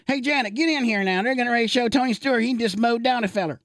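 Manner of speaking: high, excited country-husband impression; average guy calling wife. Average guy calling wife in from the dishes, high mock-urgent voice. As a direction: holler to her in a put-on high folksy voice. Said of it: "gets him to call into Mama who's doing dishes".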